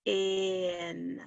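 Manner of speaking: "In" drawn out softly on the vowel; stretched vowel. Shown as a demonstration. Teacher prompting for the vowel sound, a soft, stretched "In". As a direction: hold the short vowel in "In". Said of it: "The vowel sound".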